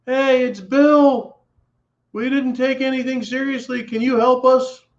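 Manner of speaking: high-pitched mock pleading voice; pleading neighbour. Voices a needy neighbour at the door.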